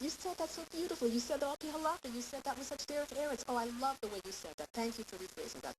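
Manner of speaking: put-on high, glowing praise for the child; warm praise. Shown as a demonstration. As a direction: gush over how nicely he asked. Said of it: "positive reinforcement".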